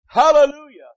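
loud high hallelujah; shouted hallelujah. Real, not acted. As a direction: call it out with joy. Preacher exclaims praise.

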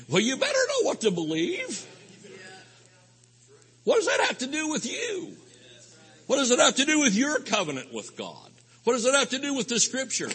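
high-pitched, exasperated challenge; incredulous retort. Acted. Preacher answering confused believers, incredulous and high-pitched.

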